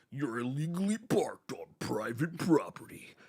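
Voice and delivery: low, whispery robot imitation; ED-209. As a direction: intone a menacing machine warning, low and hushed. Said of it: "that motherfucker was like".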